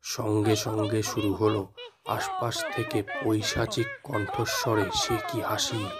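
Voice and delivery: ordinary delivery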